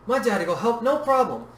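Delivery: put-on high, cheerful child voice; little girl's sunny, lilting tone; bright. A narrator's reading. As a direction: chirp it with total confidence. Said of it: "Yui said brightly".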